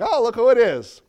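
knowing mock surprise at caller ID; sarcastic. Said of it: "You're like".

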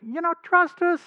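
high, loud, put-on voice of the scheming priests; high mock voice of the leaders leaning on Pilate; mocking the accusers. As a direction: sneer it out like smug accusers hiding something. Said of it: "saying, in effect".

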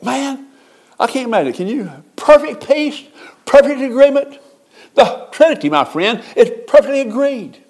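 high, hushed awe at heaven; breathless heavenly awe; rapt wonder. Acted. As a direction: marvel at heaven in a lifted voice. Preacher imagines heaven in awe.

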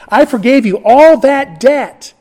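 loud, high, slow royal reproach; king's rebuke. Acted. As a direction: drive each word home as an accusation. Preacher voices the angry king.

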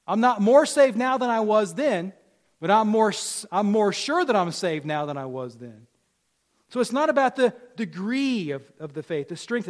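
loud, emphatic pulpit voice; forceful preaching. Real, not acted. Preacher testifying he's surer of being saved, emphatic. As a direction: hammer out the contrast loudly.

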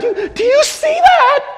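very high, stammered cry of wonder; overwhelmed outcry. Acted. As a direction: plead with them to look. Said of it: "you can't help but go".

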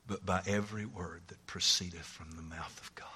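soft, voiceless scripture; whisper. Acted. Jesus answering Satan, whispered by the preacher. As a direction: whisper the verse reverently.

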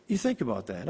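ordinary talk, nothing marked

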